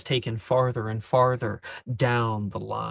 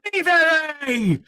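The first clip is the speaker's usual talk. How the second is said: high screaming call, much louder; 14 semitones higher, 6 dB louder than usual. Acted. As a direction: headmaster screams at the students. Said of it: "he's screaming now".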